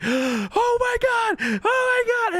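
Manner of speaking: very high, drawn-out panicked wail. Retold or imitated. Kid hears the thing outside the door, panicking. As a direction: reenact a terrified child's high squeal. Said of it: "and they're panicking, and I'm like".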